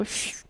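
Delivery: a faint imitated whistle for calling sheep; soft whistle. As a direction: hush out a quiet shepherd's whistle. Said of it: "da el silbido". (silbido suave de pastor)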